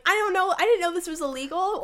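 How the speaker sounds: high, loud mock teen protest; hammed-up pleading innocence, amusement creeping in; defensive protest. Daughter protesting to her mom, high and defensive.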